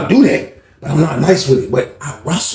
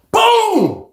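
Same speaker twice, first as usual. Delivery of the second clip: takedown boom. Retold or imitated. Sound of a takedown.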